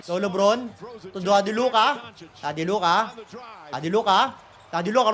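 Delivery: usual speaking voice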